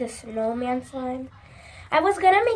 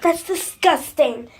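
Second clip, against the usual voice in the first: usual speaking voice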